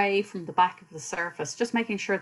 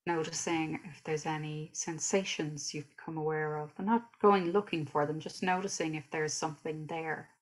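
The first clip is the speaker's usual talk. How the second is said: guided meditation; 5 dB quieter than usual. Real, not acted. Teacher leading listeners through a body scan, calm guided meditation. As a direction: guide them gently through the body, unhurried.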